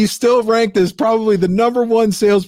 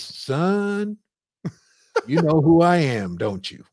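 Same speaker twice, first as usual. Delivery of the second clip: put-on knowing old mentor; 4 dB quieter, slower than usual. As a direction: lean in and ask it softly, knowingly. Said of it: "and he says".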